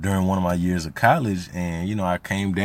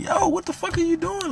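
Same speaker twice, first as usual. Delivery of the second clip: shrill, much higher incredulous yell in a strained put-on voice; exasperated outburst. Brother berating stepmom, mock-shrill exasperation. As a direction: throw it at her in disbelief. Angry retold rant at stepmom.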